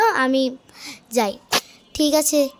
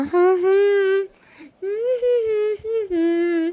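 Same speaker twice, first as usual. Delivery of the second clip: high whiny closed-mouth hums; high whimpering hum; 6 semitones higher, slower than usual. Acted. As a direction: whimper through closed lips.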